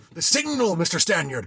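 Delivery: high, loud command; cried order. A narrator's reading. Captain ordering the signal bell, loud cry. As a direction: bark the order across the deck. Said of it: "cried the captain".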